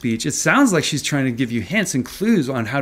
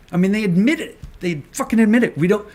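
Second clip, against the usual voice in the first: higher and louder, rising to a fast, gleeful near-shout; triumphant, can't contain the thrill of catching them; real excitement; 8 semitones higher, 5 dB louder, faster than usual. Real, not acted. Streamer catching regulators admitting it, in real excited glee. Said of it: "I'm so fucking excited".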